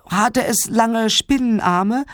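put-on high, slow little boy voice; curious child. A narrator's reading. Clemens wants to know about spider arms, high and slow. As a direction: draw out the question in a high child's voice.

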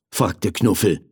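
ordinary delivery

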